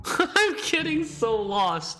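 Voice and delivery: high, drawn-out laughing wail. Real, not acted. Player lost in the chapters, laughing helplessly. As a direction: laugh through it, stretched out and high.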